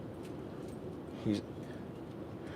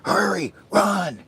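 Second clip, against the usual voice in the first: urgent; 9 semitones higher, slower than usual. Pretend. Urging him to save fire.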